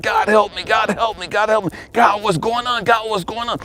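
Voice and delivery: high, pleading repeated cries; retold desperate prayer, pitch held high; crying out to God. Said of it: "I'm crying out to God".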